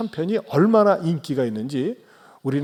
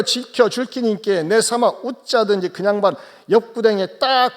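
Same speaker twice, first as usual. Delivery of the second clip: higher, hammed-up Gyeongsang dialect; 8 semitones higher, 7 dB louder than usual. Pastor recites Psalm 23 for the congregation, Gyeongsang dialect. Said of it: "경상도 버전입니다".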